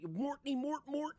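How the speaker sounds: high, squeaky cartoon nonsense; Alice the Goon gibberish. Retelling Ninja's debate, doing Alice the Goon's gibberish. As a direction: chirp the goon's words up high.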